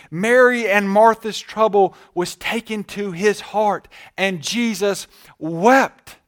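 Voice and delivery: full-force preaching. Acted. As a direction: preach it out, building to the climax. Preaching at full force on Jesus weeping.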